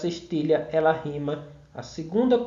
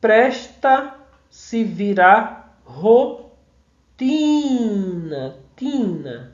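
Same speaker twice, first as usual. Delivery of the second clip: very slow "presta se virar rotina", louder, with pauses between chunks; syllable-by-syllable scansion; 3 dB louder, slower than usual. Shown as a demonstration. Teacher expanding the verse in detail, slow scansion. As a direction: break the verse into drawn-out beats, holding "Tina". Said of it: "só a expansão desse verso aqui detalhado".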